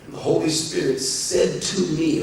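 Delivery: ordinary delivery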